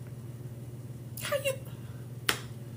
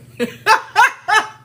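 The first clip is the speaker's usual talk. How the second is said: loud high laugh; 11 dB louder than usual. Real, not acted. Laughing at own joke.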